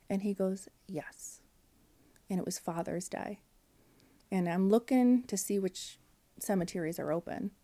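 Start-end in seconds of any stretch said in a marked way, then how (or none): 0.9-1.4 much softer, unvoiced, barely there yes; whispered yes. Quiet grieving brother agreeing to leave, tiny whisper. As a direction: let it slip out in a tiny whisper.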